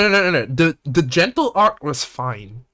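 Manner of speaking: usual speaking voice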